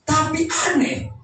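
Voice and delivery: high, emphatic sermon delivery; preaching. Real, not acted. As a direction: drive each point home from the pulpit. Preaches with raised voice. (khotbah lantang bernada tinggi)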